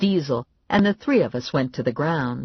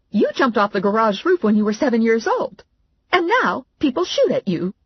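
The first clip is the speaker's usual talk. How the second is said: higher, louder scolding; scolding mother; 8 semitones higher, 4 dB louder than usual. Acted. Mother scolding her daughter, high and loud.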